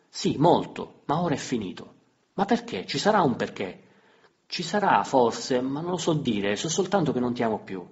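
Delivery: loud and high, voices rising; heated quarrel. Acted. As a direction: fight it out, both escalating. Lite accesa tra marito e moglie. (lite accesa, voce alta e forte)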